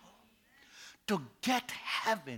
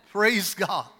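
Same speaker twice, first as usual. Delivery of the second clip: ordinary talk, nothing marked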